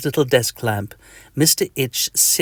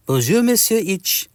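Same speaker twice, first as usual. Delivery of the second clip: put-on a mosquito with a comic French accent, higher; 8 semitones higher than usual. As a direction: greet him in a cartoon French accent.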